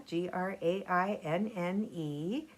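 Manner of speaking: plain reading voice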